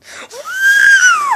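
loud, high-pitched shriek of fright; gasp, then shriek high and loud; startled scream. Real, not acted. Viewer startled by something heard, startled scream.

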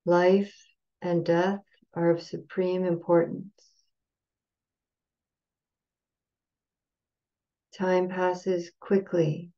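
slow call-and-repeat gatha, long pauses between phrases; measured, phrase-by-phrase chant leader, unsung; slow recitation. Shown as a demonstration. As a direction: recite slowly, leaving room to repeat after each phrase. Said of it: "I'll go say it slowly".

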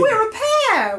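loud, high, jubilant exclamation; overjoyed. Acted. Sock tells his new friend they match, overjoyed. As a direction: burst out with delight. Simon rejoices at finding his pair.

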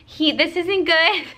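high, slow mock panic with a laugh; a light, laughing take on a freaked-out husband; imitating freaked-out husband. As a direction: play him losing it, half laughing. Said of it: "My husband's freaking out".

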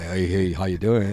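low, soft seductive mock flirt; sexy voice. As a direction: drop it low and smooth like a pickup line. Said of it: "working on your sexy voice".

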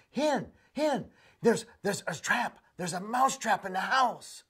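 high, urgent little mouse warning; frantic mouse. Acted. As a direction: squeak out the alarm, breathless.

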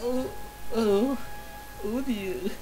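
normal talking voice

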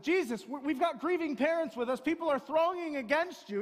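loud, high, pressing plea; urgent disciples. Retold or imitated. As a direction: push back hard at the master. Disciples pushing back at Jesus.

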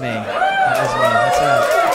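high squeaky boast; squeaky proud voice. Shown as a demonstration. Bragging to the audience that he won, high squeaky voice.

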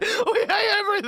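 put-on high, whiny teen misery through laughter; mock teenage wail. Teens shown off to the old women, mock wail. Acts out mortified teenagers standing there.

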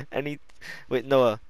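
normal talking voice

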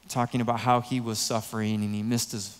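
ordinary talk, nothing marked

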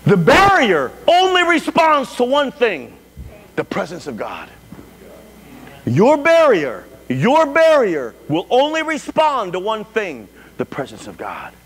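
high, slow, emphatic proclamation with hushed drops; forceful preaching. Real, not acted. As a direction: proclaim it to the congregation, stretching key words.

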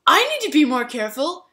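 mock insistent self-blame; overdone contrite. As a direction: ham up the repeated apology. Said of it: "the guy who spilled the drink said".

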